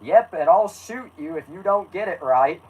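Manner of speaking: a hint of a cartoonish threat; lightly voiced Carl. A narrator's reading.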